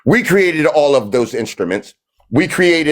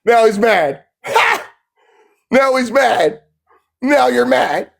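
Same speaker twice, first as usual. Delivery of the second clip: gleeful high-pitched taunt; mocking singsong jab at an angry opponent; 9 semitones higher than usual. Real, not acted. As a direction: rub it in, laughing at him. Gloating as opponent gets angry.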